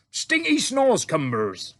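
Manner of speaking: loud, slow giant's greeting to the Queen; friendly giant. A narrator's reading. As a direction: boom it out like an eager, bumbling giant. BFG voiced in story.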